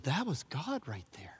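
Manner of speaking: hushed, breathless whisper; awed whisper. Acted. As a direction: breathe it like a private revelation. Preacher voicing an inner realisation.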